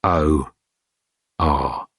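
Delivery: low, soft, giving-up sigh of words; defeated. A narrator's reading. Dirk gives up on the old man, low and soft. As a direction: let it fall away, beaten.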